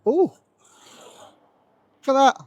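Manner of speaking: ordinary delivery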